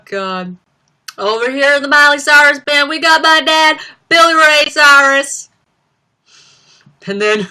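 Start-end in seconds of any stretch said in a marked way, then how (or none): 1.2-5.5 loud, high show-host parody. Acted. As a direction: introduce the band as a hammed-up pop star. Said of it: "impression of [name] on S N L doing [name]".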